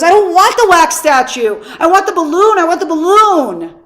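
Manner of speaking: put-on whiny little boy. Little boy refusing the wax statue, high whiny demand. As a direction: plead like a kid who won't give in. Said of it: "Little boy goes".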